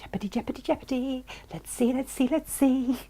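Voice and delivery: soft, high little chant while waiting to see; playful suspense. Pretend. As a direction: build mock suspense under your breath. Suspense while checking the loop.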